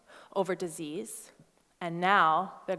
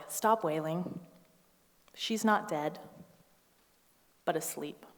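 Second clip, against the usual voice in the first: softer, lower and kind; gentle Jesus voice, softer; 4 semitones lower, 8 dB quieter than usual. Retold or imitated. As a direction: soothe the mourners gently. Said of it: "He probably said it more like".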